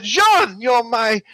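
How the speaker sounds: high-pitched gushing relief; theatrical burst of gratitude; overjoyed mock cry. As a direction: exclaim it like a hammed-up revival. Acts out revived friend's grateful cry.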